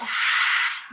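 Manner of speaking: long audible open-mouth exhale; release sigh. Shown as a demonstration. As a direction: let the breath out with a loud sigh. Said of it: "and you can release it".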